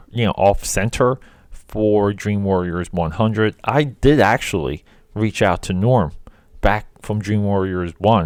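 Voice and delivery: normal talking voice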